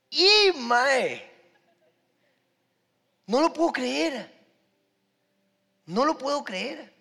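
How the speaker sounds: put-on high, loud little-boy disbelief; imitated amazed child. Boy seeing his prayer answered, imitated loud amazement. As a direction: marvel like an astonished kid, stretching the words. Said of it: "yo como niño".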